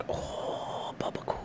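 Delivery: hushed excited whisper. Real, not acted. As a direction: whisper it to yourself. Whispers about brother's food.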